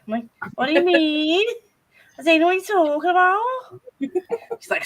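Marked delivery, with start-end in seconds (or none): 0.6-3.4 high, loud, put-on Scouse; broad Scouse accent. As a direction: play innocent in exaggerated Scouse. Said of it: "My mum hates a Scouse accent".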